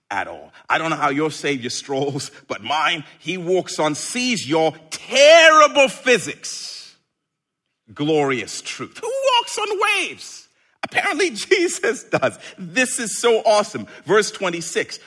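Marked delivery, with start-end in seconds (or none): 4.9-7.0 louder and slower, savour the joke; mock outrage at the physics; loud, slow punchline. Real, not acted. Preacher to congregation, loud slow punchline. 9.0-12.4 amazed. Real, not acted. Preacher marvelling to congregation, high and amazed. Amazed rhetorical question, laugh.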